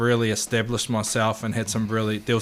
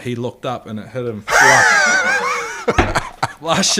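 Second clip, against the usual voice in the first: laughing; 10 semitones higher, 7 dB louder, slower than usual. Real, not acted. Laughing while retelling the hit.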